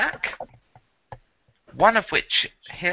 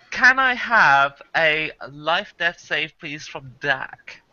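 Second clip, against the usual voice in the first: ordinary talk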